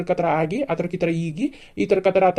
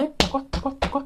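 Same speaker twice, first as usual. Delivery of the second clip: take that, high; 7 semitones higher, 5 dB quieter than usual. Acted. Father beating the in-law.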